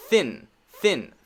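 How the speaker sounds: "thin" ends cleanly on N; clean N ending. Shown as a demonstration. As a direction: cut the N off crisply in "thin". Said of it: "This one".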